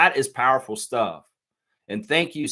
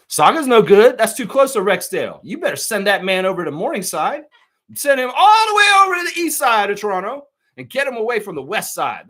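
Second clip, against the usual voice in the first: loud; 8 semitones higher, 7 dB louder than usual. Real, not acted. Heated advice to a chatter.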